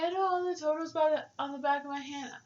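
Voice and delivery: mock her smug boasting; lightly voiced smug coworker. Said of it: "she's like".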